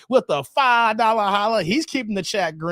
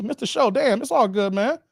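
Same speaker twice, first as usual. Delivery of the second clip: ordinary talk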